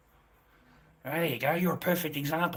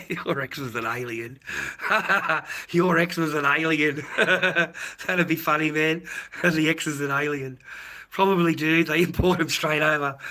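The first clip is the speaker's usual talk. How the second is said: laughing; 3 semitones higher, 7 dB louder, slower than usual. Real, not acted. Laughing at own alien joke.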